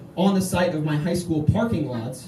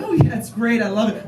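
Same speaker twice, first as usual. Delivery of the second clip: fake-happy Midwest voice; 5 semitones higher, 3 dB louder than usual. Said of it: "My Midwestern voice is the voice".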